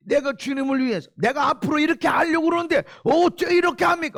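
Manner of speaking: high-pitched, protesting mock prayer; arguing with God. Believer complaining to the Lord about spouse and children, arguing. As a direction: act out a believer loudly taking God to task. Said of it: "주님 앞에 놓고 따지고 주님을 혼내는".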